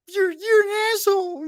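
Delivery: high-pitched imitation of a sore loser; mock angry woman. Man mimics the beaten woman cursing him out, high and mock-angry. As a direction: squeal the insult like a furious woman. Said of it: "every profane word known to man".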